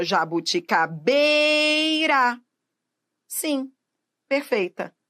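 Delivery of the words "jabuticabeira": "jabuticabeira" with "bei" greatly stretched; stress on BEI. Shown as a demonstration. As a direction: hold the next-to-last syllable of "jabuticabeira". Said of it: "é um vocábulo paroxítono".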